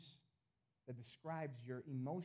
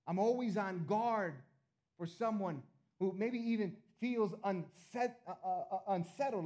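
slower, louder, higher preacher's build; preaching loud; 5 semitones higher, 8 dB louder than usual. Real, not acted. Pastor pressing the point to the church, loud and slow. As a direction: lift it up and lean into every word.

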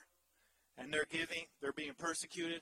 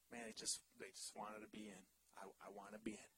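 hushed longing; 10 semitones lower, 12 dB quieter than usual. Acted. Preacher voicing people who just want in, very soft. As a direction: drop very soft, almost breathed. Quiet voicing of eager givers.

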